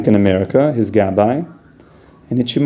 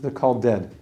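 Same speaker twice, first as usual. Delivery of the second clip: hushed, grave; 6 dB quieter than usual. Real, not acted. Rabbi telling listeners the wicked are dead, hushed and grave. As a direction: let it fall soft and heavy.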